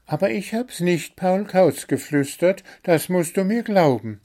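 a tiny bird begging to be believed; the little bird insists it is innocent, pleading. Acted.

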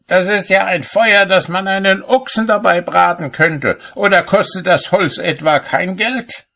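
high, loud indignant scolding; shouted reproach. A narrator's reading. Cobbler scolds his wife over a wasteful fire, high-pitched shouting. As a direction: keep the pitch pinned high, berating. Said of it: "schrie er".

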